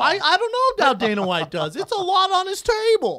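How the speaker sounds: high, loud mock protest; mocking imitation. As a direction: mimic him in an exaggerated high yell.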